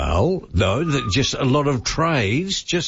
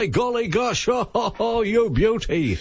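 put-on high, excited old footy caller cry; hammed-up Lou Richards delight; Lou Richards impression; 10 semitones higher than usual. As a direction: whoop it up like a delighted commentator. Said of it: "He, he says".

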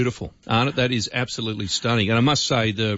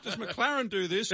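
laughing; 9 semitones higher, 9 dB quieter than usual. Real, not acted. Laughing at himself mid-bite.

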